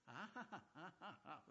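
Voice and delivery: breathy chuckle, ha ha ha; a short amused laugh; real laugh at the story. Real, not acted. Laughs at the Pharisees' line.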